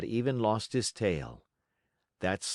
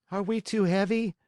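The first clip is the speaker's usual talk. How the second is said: lightly voiced eager puppet boy; a worried little wooden boy asking; 11 semitones higher than usual. Pinocchio asks the fish.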